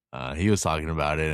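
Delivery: ordinary talk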